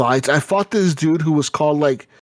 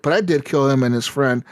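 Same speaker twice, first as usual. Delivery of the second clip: ordinary talk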